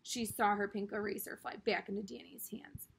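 ordinary delivery, nothing marked

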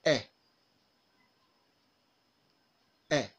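"Eh" as a short, half-open front vowel; short vowel demo. Shown as a demonstration. Teacher to learners repeating after them, short lax "Eh". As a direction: model "Eh" briefly with lips spread and unrounded. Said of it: "Now listen, repeat after me".

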